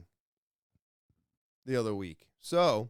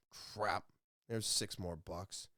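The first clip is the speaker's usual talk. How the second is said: muttered aside; 7 semitones lower, 11 dB quieter, slower than usual. Retold or imitated. Adult sees dropped ice cream.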